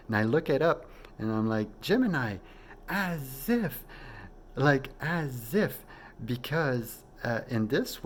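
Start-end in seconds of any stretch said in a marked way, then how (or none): 1.8-5.8 much higher, awed and drawn out at the end; stunned discovery. Retold or imitated. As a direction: it all clicks, disbelief. Discovers aunt is Gemini.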